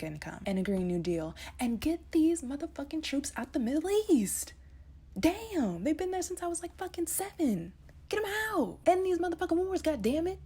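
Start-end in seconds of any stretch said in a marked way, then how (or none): 1.6-10.4 rising into a furious, higher, louder yell; angry rant. Real, not acted. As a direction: let the frustration boil over into shouting. Building rant about troops and wars.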